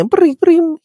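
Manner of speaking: high little trilled chirps, imitating alien chatter; high-pitched alien chirp. Purple people chattering to each other, high-pitched mock chirps. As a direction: chirp it high like a tiny machine. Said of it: "what's that high-pitched noise you're making".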